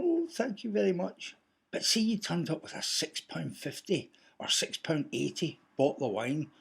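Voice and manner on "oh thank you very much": higher-pitched polite thanks, lightly acted; lightly put-on grateful host; gracious host. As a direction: imitate a host politely receiving a gift. Imagined host receiving a gift.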